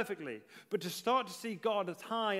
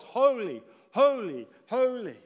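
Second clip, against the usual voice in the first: loud, ringing proclamation, each word set apart; punched, louder call in imitation of seraphim; 4 semitones higher, 9 dB louder than usual. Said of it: "with the seraphim calling out".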